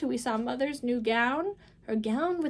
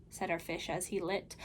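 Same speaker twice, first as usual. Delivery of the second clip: plain reading voice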